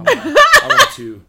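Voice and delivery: high squeal, laughing hard. Pretend. Squealing fan voice.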